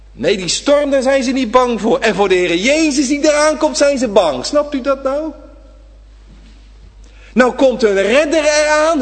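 loud, high fervent preaching; preacher thundering to the congregation; full-force preaching. Acted. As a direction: drive it home, rising. Sermon climax preaching.

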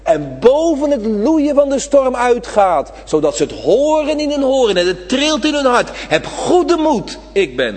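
preacher proclaiming Jesus' voice over the storm; full-force preaching. Acted. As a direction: let the voice soar over the storm. Preaching the powerful voice.

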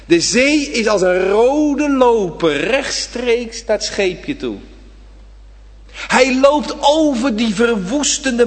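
slow, swelling preacher climax; rising sermon fervour. Acted.

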